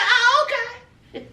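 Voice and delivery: high, drawn-out mock okay; put-out okay. Man told not to touch her, put-out okay. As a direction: imitate someone slowly taking in a snub. Acted reply to being refused.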